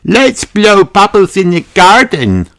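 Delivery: put-on high, loud little girl call; excited piggy shout. A narrator's reading. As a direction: call everyone out to the garden. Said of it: "shouts Peppa".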